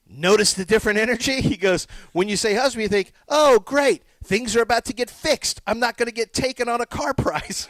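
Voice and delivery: animated retelling of a stand-up routine; imitating a comedian.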